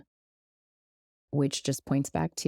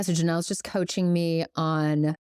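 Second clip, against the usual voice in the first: ordinary delivery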